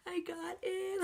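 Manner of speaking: high, choked-up wail; mock-sobbing high squeak; retold crying. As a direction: imitate barely talking through sobs. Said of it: "I was, like, obviously crying. Like, I couldn't talk".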